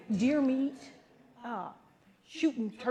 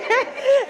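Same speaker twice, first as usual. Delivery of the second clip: loud high laugh; 14 semitones higher, 9 dB louder than usual. Real, not acted. Laugh after impression.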